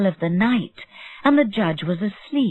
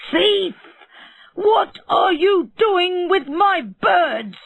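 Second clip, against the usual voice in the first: shouting; 12 semitones higher, slower than usual. Acted. Bird seller yells at the dwarf near his birds, shouting. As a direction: scream it at the intruder, drawn out. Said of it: "he shouted".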